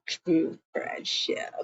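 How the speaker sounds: whispered sarcastic aside. Real, not acted. Recapping a show to viewers, a mocking whispered aside. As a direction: breathe it like a secret. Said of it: "sarcastic aside".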